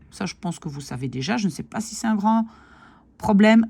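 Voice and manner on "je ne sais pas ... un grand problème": normal talking voice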